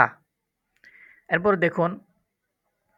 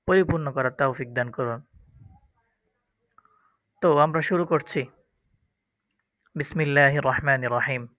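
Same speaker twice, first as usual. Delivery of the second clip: ordinary talk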